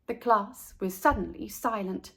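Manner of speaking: plain reading voice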